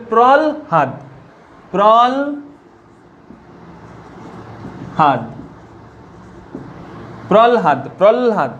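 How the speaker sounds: প্রলহাদ said as prol-had, l before h; teacher drills প্রলহাদ as prolhad; pronunciation demo. Shown as a demonstration. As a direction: repeat প্রলহাদ with the l sound first. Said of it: "দেখো কি উচ্চারণ হবে?".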